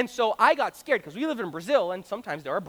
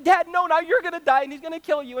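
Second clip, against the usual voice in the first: put-on panicked kid; 7 semitones higher, 5 dB louder than usual. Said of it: "and I said".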